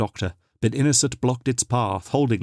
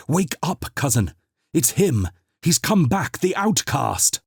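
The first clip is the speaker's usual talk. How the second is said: frantic; 7 semitones higher, slower than usual. Acted. Frantic waking of old woman.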